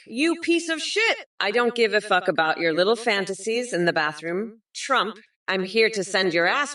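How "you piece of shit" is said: angry insult. Acted. Insulting Trump in rant.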